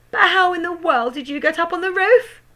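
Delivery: high baffled outcry; bewildered cry. A narrator's reading. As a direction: cry out in confusion. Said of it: "cried Mother".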